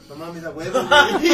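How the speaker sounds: loud, laughing whoop of delight; hyped yell at the keyboard; excited cheer. Retold or imitated. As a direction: shout it out like the music just hit. Cheering while playing keyboard.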